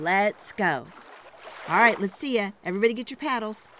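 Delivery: plain reading voice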